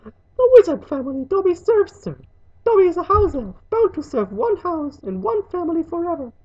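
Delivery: high, loud elf voice; squeaky, earnest servant; dutiful house elf. Acted. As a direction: recite your servitude as a high-voiced elf.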